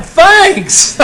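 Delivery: high, loud, laughing fake gratitude; sarcastic thanks. Teacher retelling his comeback to the ladies, laughing mock thanks.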